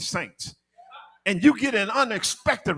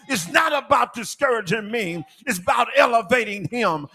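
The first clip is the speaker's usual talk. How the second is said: driving, raised sermon voice with hardly a break; full-force preaching; 4 semitones higher than usual. Real, not acted. Preacher urging church to elevate God over division, full-force preaching. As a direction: press on urgently, punching the key words.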